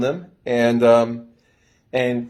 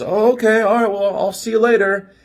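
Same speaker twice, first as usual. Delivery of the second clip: high, louder sheepish goodbye; mock flustered self; 11 semitones higher, 5 dB louder than usual. To the two girls leaving, his own high flustered goodbye. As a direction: play yourself caving in, chirpy and high. Caving as they leave.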